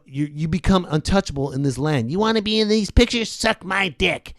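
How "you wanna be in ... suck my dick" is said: lightly voiced bullying producer; Harvey Weinstein impression. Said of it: "That's my Harvey Weinstein impression".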